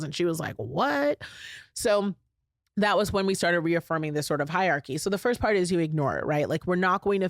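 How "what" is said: daughter's shocked what. Retold or imitated.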